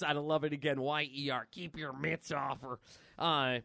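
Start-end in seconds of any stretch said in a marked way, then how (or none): 1.5-2.8 low, slow, softer warning. Acted. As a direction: warn them off slowly and low.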